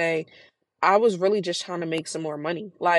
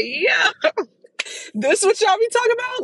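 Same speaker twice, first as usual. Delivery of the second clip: laughing; 14 semitones higher than usual. Retold or imitated. Laughing retelling her shocked reaction.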